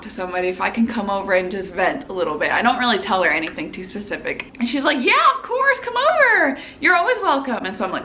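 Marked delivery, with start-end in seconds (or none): 5.0-7.6 eager welcome. Retold or imitated. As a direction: imitate a friend happily inviting someone over. Voicing friend's eager invitation.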